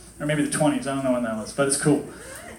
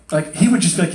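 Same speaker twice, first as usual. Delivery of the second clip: ordinary talk, nothing marked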